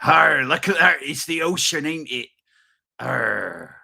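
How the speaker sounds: mock pirate impression with Arr. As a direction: growl it like a cartoon pirate. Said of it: "that of a pirate's voice".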